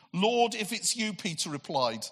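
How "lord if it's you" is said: higher, impulsive cry to his Lord; eager, heartfelt call; passionate outburst. Acted. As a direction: blurt it out with no self-control. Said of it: "here comes the voice of someone who passionately loves Jesus".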